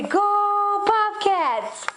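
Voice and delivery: very slow, high, drawn-out cheer; slow cheer for baby. Shown as a demonstration. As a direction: stretch it out so the baby can copy. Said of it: "Can you say".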